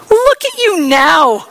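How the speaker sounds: high, loud, slow jeering shout; mocking yell. Passersby jeering at Jesus, loud mocking yell. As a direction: yell it down at the cross. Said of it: "they yelled at him".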